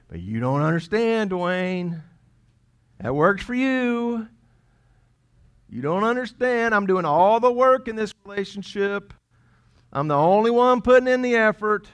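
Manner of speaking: high, loud mock whining protest; indignant objector. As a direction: protest like an aggrieved, hard-done-by partner. Voices a listener's indignant objection.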